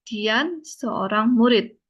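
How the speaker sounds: "Ya, Dian seorang murid." said slowly, ending on a fall; slow model answer. Shown as a demonstration. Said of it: "Lalu jawabnya". (contoh jawaban dibaca pelan)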